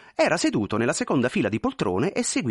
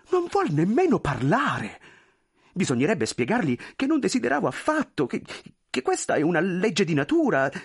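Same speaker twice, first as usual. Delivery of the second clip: much higher, pleading, flustered voice; anxious clerk; 5 semitones higher than usual. Acted. Clerk pleading with the general, high and flustered. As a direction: beg forgiveness nervously.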